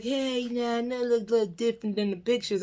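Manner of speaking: lightly voiced sheepish man, quick and soft; mock sheepish date. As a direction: rush the excuse a bit apologetically. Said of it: "he's just like".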